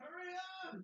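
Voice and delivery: high-pitched imitation of yelling; mock yell. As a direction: shout it high, hurrying a teammate along. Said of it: "Yelling at each other like".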